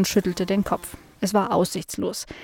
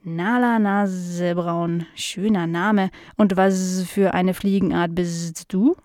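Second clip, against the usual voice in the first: slow housefly with drawn-out buzzing s sounds; curious housefly; slower than usual. Acted. Fly meeting Nala for the first time, slow and buzzy. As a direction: stretch the words like a dopey, curious fly.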